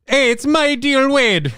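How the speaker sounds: put-on Jamaican Fargo accent. Cohost joining the Fargo bit, slow Jamaican Fargo accent. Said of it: "Jamaican Fargo".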